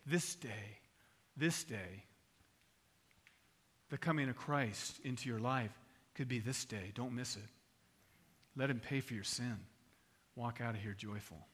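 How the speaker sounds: low, soft altar appeal with long pauses; hushed, measured sermon delivery; solemn preaching. Real, not acted. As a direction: bring it down to a grave, tender plea.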